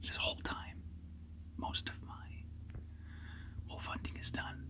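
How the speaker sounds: hushed whisper so game can't hear. Real, not acted. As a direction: keep it to a whisper in the blind. Hunter whispering in blind.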